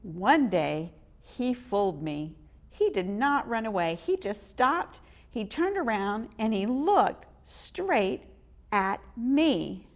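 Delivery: ordinary delivery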